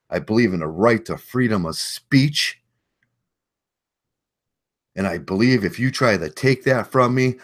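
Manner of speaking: loud, high, fired-up speech; patriotic rant. Real, not acted. Veteran ranting to viewers, fired up and loud. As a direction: preach it with rising passion.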